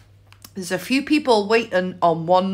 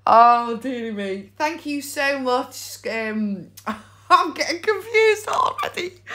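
ordinary talk

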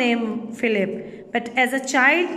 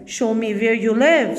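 louder, rough convict voice; convict's order; 3 dB louder than usual. A narrator's reading. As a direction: order him like a hunted man.

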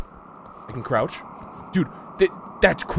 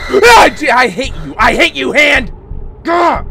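high panicked yells at a jump scare; shrieking in fright; startled screaming; 11 semitones higher, 11 dB louder than usual. Real, not acted. Jump scare in horror game.

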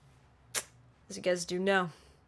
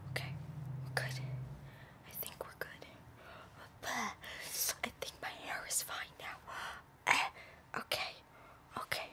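whispering; 20 dB quieter than usual. Real, not acted. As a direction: whisper while fixing hair. Whispered adjusting hair.

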